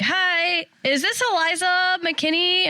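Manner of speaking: high, chirpy phone greeting; perky saleslady. Retold or imitated. Mom imitating the dealership caller, high and chirpy. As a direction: sound like a bright sales caller. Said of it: "the lady's like".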